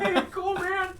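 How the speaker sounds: very high, loud eager voice; squeaky excited imitation; mock excited. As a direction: overeager kid thrilled by everything. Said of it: "always excited for everything".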